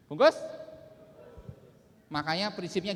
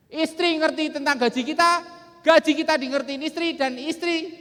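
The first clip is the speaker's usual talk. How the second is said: loud, high-pitched motivational delivery; rousing seminar declamation; 11 semitones higher, 12 dB louder than usual. Real, not acted. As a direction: drive each point home to the hall. Declaims five principles to the hall.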